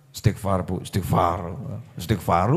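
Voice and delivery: low, soft, monotone chiding; mock pious husband. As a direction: imitate a husband calmly urging istighfar. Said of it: "istrinya marah-marah". (suami sok kalem menegur)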